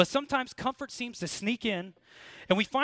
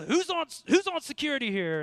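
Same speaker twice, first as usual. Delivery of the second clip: mock yell, pitched higher; retold yell; 7 semitones higher than usual. Instructor catching the sleeping platoon, a higher mock yell. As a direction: call out like a drill instructor, half-acted. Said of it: "he yelled".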